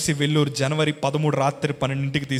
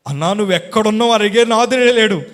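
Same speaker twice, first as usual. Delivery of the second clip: much higher, louder and fast, climbing to a cry; rising preacher cry; 8 semitones higher, 9 dB louder than usual. Acted. Preacher lamenting abandonment, building to a loud cry.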